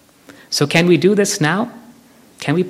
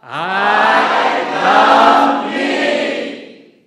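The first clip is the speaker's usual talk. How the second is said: a long, drawn-out, sing-song group call, higher; shout it out; 7 semitones higher, much slower than usual. Real, not acted. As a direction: raise the roof together, chanting slowly. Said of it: "let's shout it out until the roof falls off".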